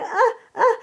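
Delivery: short, high-pitched "uh, uh"; imitated toddler grunts. Mother describing how a toddler points to communicate, imitated high grunts. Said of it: "sometimes she points and kinda".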